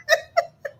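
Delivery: squeaky high giggle. Real, not acted. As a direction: giggle helplessly. Overwhelmed by super chat.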